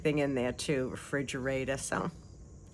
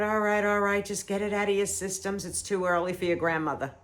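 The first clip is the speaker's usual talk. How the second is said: higher, coaxing words to barking dogs; talking to the dogs; 6 semitones higher, 3 dB louder than usual. Retold or imitated. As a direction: humour the noisy dogs, a bit louder.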